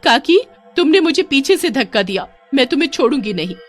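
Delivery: high, loud, fast cry from the water; desperate shout. Acted. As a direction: yell at her while going under. Pushed into drain, drowning. (ऊँची घबराई चीख़ती आवाज़ में)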